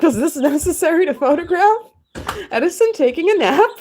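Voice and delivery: high, loud mock disbelief; mock incredulity. Streamer questioning photos of Edison napping, mock incredulous. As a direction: question it in exaggerated, high-pitched bafflement.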